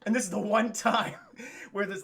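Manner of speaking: normal talking voice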